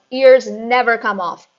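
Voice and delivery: very loud shout. A narrator's reading. Headmistress shouting at the teacher, very loud. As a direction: bellow it down. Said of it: "the Trunchbull shouted".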